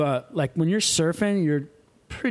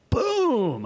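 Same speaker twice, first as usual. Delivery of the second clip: high, drawn-out vocal boom; imitated gunshot boom; 9 semitones higher, 3 dB louder than usual. Storyteller mimicking the second spear shot, high boom.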